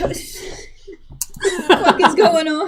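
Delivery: laughing. Retold or imitated. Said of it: "Lapis is just like".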